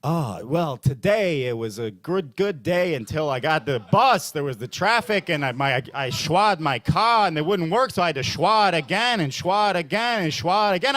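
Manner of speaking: put-on kvetching voice with a rising singsong. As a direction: list the day's woes in a lilting put-on voice. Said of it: "struggle within me that it's like".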